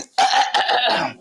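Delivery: choking cough; coughing up water. Real, not acted. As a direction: hack it out. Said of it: "that water went down the wrong pipe".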